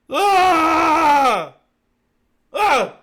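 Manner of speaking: loud, high scream, then a short one; mock scream. Host coming out of seance possession, screaming. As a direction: scream out as the spirit leaves.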